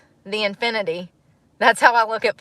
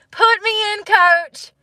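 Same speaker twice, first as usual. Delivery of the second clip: pleading squeal; 12 semitones higher, slower than usual. Pretend. Repeated plea, much higher.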